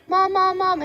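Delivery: high, loud calls of Mom; panicked yelling for mom after cutting herself; retold screaming for mom. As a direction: yell for your mother in a panic. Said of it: "I was screaming and I was like".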